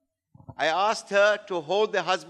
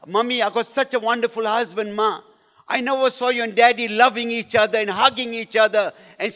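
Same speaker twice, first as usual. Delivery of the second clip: higher-pitched pleading, fading at the end; imitated emotional plea; 4 semitones higher than usual. Wife unburdening herself to Ma, imitated emotional plea. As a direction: pour it out to Ma, acting distraught. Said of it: "it was emotional".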